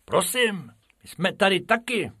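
high, polite little rabbit voice; Bob asking politely. Acted. Bob reminding the keeper they're there, polite. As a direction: pipe up to be noticed.

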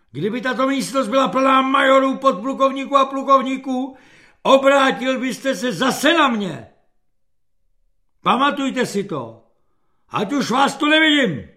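high, loud officer's tirade; general's roar. A narrator's reading. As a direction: bellow a reprimand and dismiss him. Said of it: "generálův řev". (generálský řev)

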